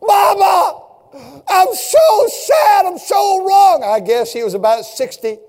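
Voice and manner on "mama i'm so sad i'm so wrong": high, loud sobbing wail; grieving son screaming apologies to his dead mother; wailing grief. Retold or imitated. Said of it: "Screamed out".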